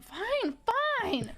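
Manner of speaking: mock sassy giving-in; sassy retort, pitch jumping then dropping. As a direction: imitate a sulky, sassy concession. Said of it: "He's being so sassy".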